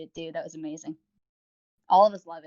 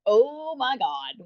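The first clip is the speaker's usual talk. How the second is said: mock shocked gasp; 6 semitones higher, 6 dB louder, much slower than usual. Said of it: "And it's like".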